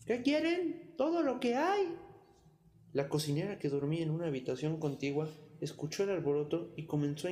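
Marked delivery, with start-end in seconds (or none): 0.1-1.9 put-on high, loud yell of a tiny boy; full-lung shout. A narrator's reading. Pulgarcito pretends not to hear the robbers, high loud shout. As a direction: shout at the top of your lungs. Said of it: "replicó a todo pulmón".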